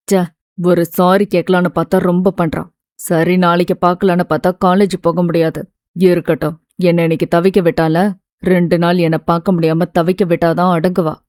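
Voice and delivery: a miffed lover thinking aloud, lightly voiced; young man grumbling to himself. A narrator's reading. Siva's thoughts in male voice.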